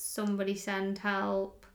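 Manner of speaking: normal talking voice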